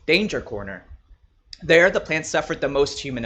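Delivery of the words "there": usual speaking voice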